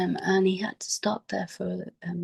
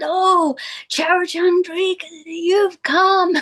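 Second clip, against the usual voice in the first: much higher and louder, a joyful exclaimed welcome; delighted greeting; 11 semitones higher, 6 dB louder, slower than usual. Retold or imitated. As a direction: imitate an old man lighting up at seeing a friend. Elder greets narrator with delight.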